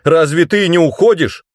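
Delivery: high, loud questioning cry; alarmed shout. A narrator's reading. Hero asks whether Tananda is leaving too, alarmed shout. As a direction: call out the question urgently. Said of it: "крикнул я".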